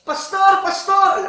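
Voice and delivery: imitating a woman shouting across the mall, high and loud; loud call. Churchgoer woman spotting her pastor, loud high shout. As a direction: call out Pastor at full voice from afar. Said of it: "Lakas ng boses ng babae".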